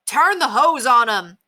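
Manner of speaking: loud, slow, high-pitched shout. A narrator's reading. Bystander tells neighbor how to stop dogs, shouting. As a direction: shout an urgent instruction, stretched out. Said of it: "someone shouted".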